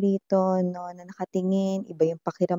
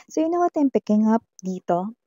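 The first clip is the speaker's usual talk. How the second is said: ordinary talk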